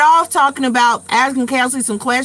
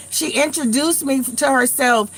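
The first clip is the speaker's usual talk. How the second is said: ordinary talk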